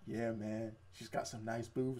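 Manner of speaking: low, soft aside between buddies; a hint of a leering guy, kept quiet; lightly voiced ogling guy. Guys on bleachers watching a girl run, mock leering.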